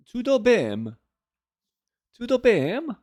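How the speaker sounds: attempted Portuguese accent. Shown as a demonstration. Streamer tests the phrase on chat, mock Portuguese accent. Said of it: "with, like, a Portuguese accent".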